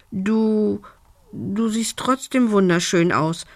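a shy little dragon stumbling over the first word; embarrassed stammer. A narrator's reading. Little dragon shyly admiring the elf, stammering. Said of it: "stotterte Lumbi verlegen".

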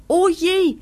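hohes, lautes Oh je; erschrocken. A narrator's reading. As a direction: erschrocken aufrufen. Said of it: "sagt Stups erschrocken". (erschrockener Ausruf)

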